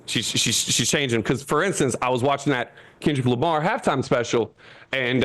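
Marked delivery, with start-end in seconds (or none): none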